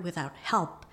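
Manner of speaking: usual speaking voice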